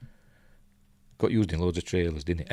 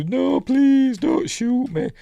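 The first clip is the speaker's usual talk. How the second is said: squeaky hammed-up plea for mercy, higher; mock high begging; 4 dB louder, slower than usual. Villain begging for his life in a mock squeaky voice. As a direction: play the pleading villain, high and panicky. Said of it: "he's begging for his life".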